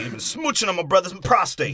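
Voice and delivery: a hint of a sleazy thug; mock mobster. Mick voiced in movie riff.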